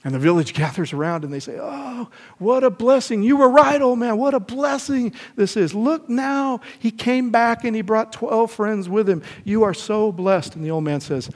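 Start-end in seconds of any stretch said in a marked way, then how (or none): 1.6-10.6 much higher and louder, slow, overjoyed crowd; squealing mock delight, high and loud; gushing villagers. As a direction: ham up the villagers' glee at the twelve horses. Villagers cheer the returned horses.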